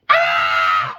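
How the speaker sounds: a sudden loud startled yelp; imitating a shocked cry on seeing him; mock shocked shout. Comedian startled by her at the door. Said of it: "He's like".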